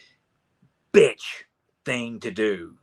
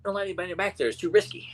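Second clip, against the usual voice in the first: high, flustered little-guy voice; high-pitched nervous backpedaling; flustered refusing character; 9 semitones higher, 4 dB louder, faster than usual. Retold or imitated. Acting out Squinch refusing, flustered.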